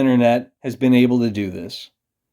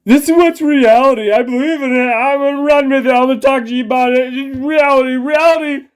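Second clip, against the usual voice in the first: much higher, louder mocking parrot voice; mock sheep voice; 12 semitones higher, 4 dB louder, faster than usual. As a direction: mimic a gullible follower repeating what they're fed. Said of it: "they buy into what's at the top of the fucking feed".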